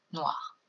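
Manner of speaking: noir as one short soft syllable. Shown as a demonstration. Teacher modelling the rhythm word: single-syllable noir. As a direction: clip noir to one beat. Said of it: "on a une syllabe".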